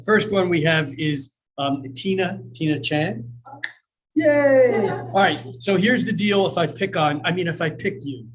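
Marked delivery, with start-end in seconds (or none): 4.1-4.9 high, loud little cheer; bright whoop of delight; excited cheer. Real, not acted. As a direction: cheer the first name drawn. Cheering the first name drawn.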